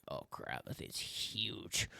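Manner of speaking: hushed, breathless whisper of awe; awed whisper. Retold or imitated. Hunter in his stand spotting a huge buck, awed whisper.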